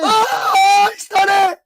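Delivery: high, loud triumphant yell; excited shout. Real, not acted. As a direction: shout it like a goal went in. Host celebrates winning answer.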